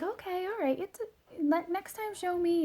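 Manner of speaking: high-pitched, gentle mommy voice; light, high "it's okay" parenting voice; acted reassurance. Mom reassuring her scared child.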